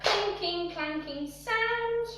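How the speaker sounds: clinking, clanking sound stretched out slowly and rising high; drawn-out cue. Shown as a demonstration. Dance teacher calling the music cue, slow and rising. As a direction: draw the words out like the clanking music. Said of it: "That's nice and sharp".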